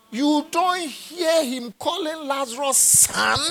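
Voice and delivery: high, marvelling exclamation; preacher marvelling aloud, pitch up; amazed, pitched high. Acted. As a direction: sound astonished at the word son. Marvelling at Abraham's word.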